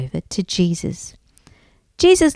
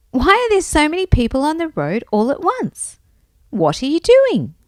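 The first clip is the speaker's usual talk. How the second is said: higher-pitched, curious call; 6 semitones higher than usual. A narrator's reading. Blind Bart asks the passing crowd, calling out. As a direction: question the passers-by in a raised voice. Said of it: "he called out".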